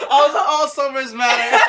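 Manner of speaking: high laughing squeal. Real, not acted. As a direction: laugh through the words. Joking parody slogan.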